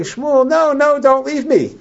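cling to him and beg him to stay; retold cry of a king who is afraid of being left; desperate plea. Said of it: "Shaul is saying".

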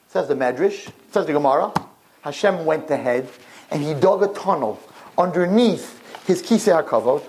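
usual speaking voice

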